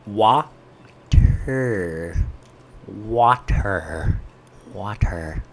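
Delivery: low "Water" with a hard t and full r; over-enunciated water. Shown as a demonstration. Streamer practising the word for chat, over-enunciated "Water". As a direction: split "Water" into wa-TER, drawn out. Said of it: "can you say water".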